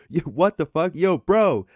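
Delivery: indignant shout. Retold or imitated. Narrator shouting at his friend who slammed the door, indignant shout. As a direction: shout at him after the door slams.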